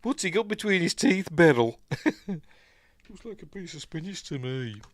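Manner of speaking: high-pitched comic impression voice; Jasper Carrot. Podcaster quoting a comedian's routine, high comic voice. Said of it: "Do you know about Jasper Carrot?".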